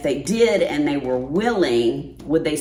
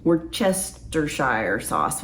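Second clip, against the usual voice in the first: slowed down and softer; slow, careful; 4 dB quieter, slower than usual. Real, not acted. Slow second try at the tricky sauce name. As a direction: draw the word out carefully. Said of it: "Why do I slow down when I say that".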